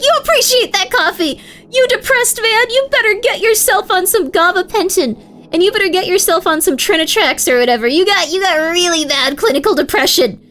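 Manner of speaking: high, loud indignant tirade; hammed-up retold tirade; mock rage. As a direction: rail at the stranger as if defending family. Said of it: "I was really angry".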